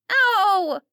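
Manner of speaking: high, loud yelp then protest; a hurt kid shouting back; girl crying out in pain. A narrator's reading. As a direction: daughter yelping after her pigtail is yanked. Pigtail yanked.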